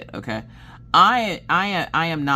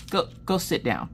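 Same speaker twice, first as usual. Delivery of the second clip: restrained mock-weary dismissal; understated exasperated command; retold weary order; 5 semitones higher, 4 dB quieter than usual. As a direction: send them away with tired patience. Husband's weary order, first take.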